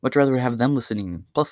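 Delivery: ordinary talk, nothing marked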